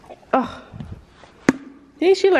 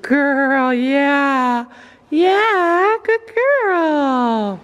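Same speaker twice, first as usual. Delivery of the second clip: soothing praise; much slower than usual. Real, not acted. Praising the pony.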